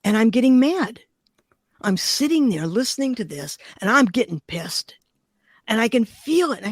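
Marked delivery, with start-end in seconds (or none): none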